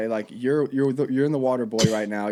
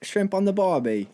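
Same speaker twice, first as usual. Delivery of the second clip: higher-pitched bad Australian accent; hammed-up amateur Australian; badly faked Australian twang; 6 semitones higher than usual. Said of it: "let's practice our accents".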